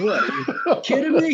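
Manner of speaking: high, incredulous retold reaction; mock disbelief. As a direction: act out being floored, pitched well up. Retold disbelief at a big bet.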